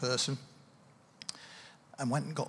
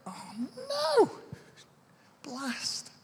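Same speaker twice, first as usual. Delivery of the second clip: acted dismay; 11 semitones higher, much slower than usual. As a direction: play up the horror at your own slip. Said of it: "And you go".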